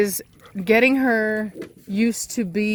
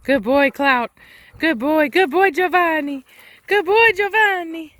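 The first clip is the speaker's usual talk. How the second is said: higher, louder upbeat dog praise; calling the dogs; 7 semitones higher, 4 dB louder than usual. Real, not acted. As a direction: call the dogs across the yard.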